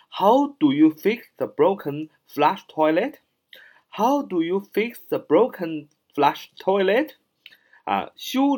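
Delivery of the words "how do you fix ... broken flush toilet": 慢速示范朗读. Shown as a demonstration. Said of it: "所以用how打头啊".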